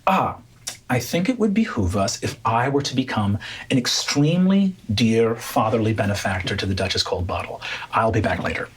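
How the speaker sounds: low, far-off scheming noble voice; Calroy's voice. Acted. Calroy tells the group he'll adopt the duchess, low and sly. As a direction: drop the voice low and smug, like a scheming lord. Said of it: "and he's like".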